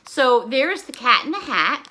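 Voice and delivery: ordinary talk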